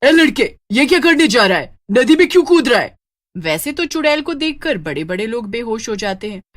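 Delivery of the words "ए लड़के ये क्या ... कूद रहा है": loud, higher witch shout; witch calling out. Acted. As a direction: holler at the boy to stop, as a witch. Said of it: "चुड़ैल राज का हाथ पकड़ लेती है और बोलती है".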